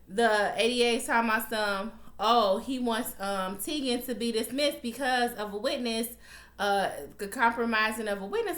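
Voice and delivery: ordinary talk, nothing marked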